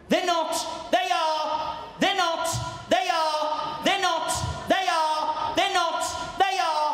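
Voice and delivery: put-on high, childish tit-for-tat bickering; mock bickering. Husband and wife bicker over underpants, high mock squabble. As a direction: trade they're not and they are like squabbling kids. Acted back-and-forth quarrel.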